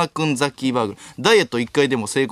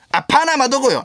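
shrill questioning shout up high; comic high yell; 11 semitones higher, 3 dB louder than usual. Acted. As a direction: yell it like a lost traveller. Repeating the gag line.

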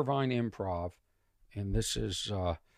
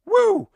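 shrieked high woo, arms up; mock party woo; 10 dB louder than usual. Comic imitating a heckler's piercing woo. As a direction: imitate the drunk lawyer's woo. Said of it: "you can hear go".

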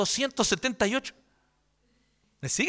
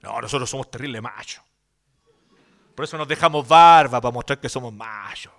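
lightly voiced tough-guy swagger, lower; mocking macho preachers, a touch lower; mock macho men; 10 semitones lower, 4 dB louder than usual. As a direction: ham up the macho evangelical. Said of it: "salen los evangélicos súper machos".

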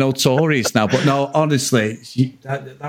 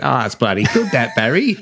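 Cockney Australian wife; 3 semitones lower than usual. Retold or imitated. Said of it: "Fucking great accent".

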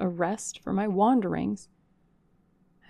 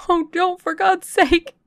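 much higher, louder anguished cry; desperate cry; 12 semitones higher, 11 dB louder than usual. Acted. Stranger crying to the servant shutting her out, anguished shout. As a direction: cry out to stop the door. Begging as door closes.

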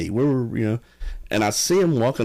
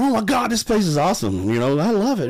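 put-on much higher, like a kid in a candy store; imitating the excited buddy touring the haunted house; 10 semitones higher than usual. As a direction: gush like a thrilled kid. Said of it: "He's running around".

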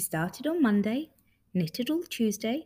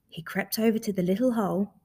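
usual speaking voice

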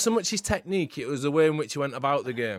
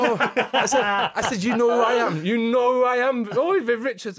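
starstruck delight; 8 semitones higher than usual. Retold or imitated. Young player amazed Viv knows him, excited. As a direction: gasp it like meeting your idol.

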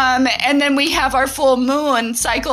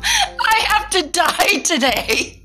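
quoting daughter, laughing; 9 semitones higher than usual. Retold or imitated. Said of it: "and says".

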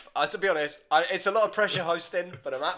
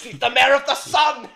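loud, high hammed-up cry; mock outburst; 9 semitones higher, 10 dB louder than usual. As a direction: top the joke with a bigger yell. Tops co-host's mock shouted answer.

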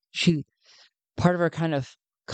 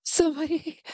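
breaking into tears; 13 semitones higher than usual. Acted. Nadiz breaking down to Sven, voice cracking high. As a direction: voice cracking much higher. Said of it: "she can't fight back the tears".

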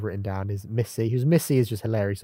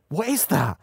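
higher, exclaimed question; startled mock question; 6 semitones higher, slower than usual. Fan quoting woman who sees Nardole, mock startled. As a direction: blurt it out as a taken-aback onlooker.

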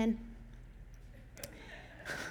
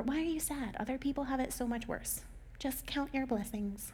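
dismissive adult; 3 semitones higher, 10 dB quieter than usual. Acted. Imitating adults telling someone not to be upset, softly dismissive. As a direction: gently brush off someone's sadness, in a put-on voice. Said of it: "when we're adults, that same language turns into".

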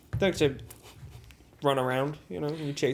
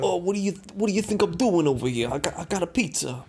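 indignant put-on Italian American; Italian American accent; 3 semitones higher than usual. Performer answering pizza demand, indignant Italian American accent. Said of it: "Italian American".

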